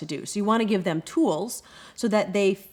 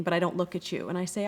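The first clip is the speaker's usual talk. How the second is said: normal talking voice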